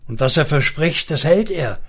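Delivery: usual speaking voice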